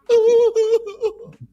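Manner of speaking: loud high laugh. Real, not acted. Big laugh at own joke.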